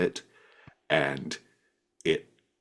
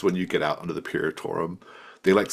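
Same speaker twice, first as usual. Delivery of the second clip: ordinary delivery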